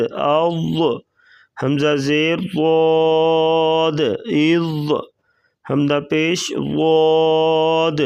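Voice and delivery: slow, measured spelling-out of each letter; qaida drill recitation. Shown as a demonstration. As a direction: chant the syllables as a teacher drilling children.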